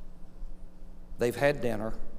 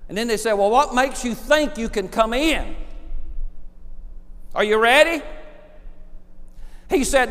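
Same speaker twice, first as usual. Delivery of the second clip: loud, high, ringing preacher voice; preaching at full force; 8 semitones higher, 8 dB louder than usual. Acted. Preacher tells the thief's story to his congregation, loud and rising. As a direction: build the story up to a shout.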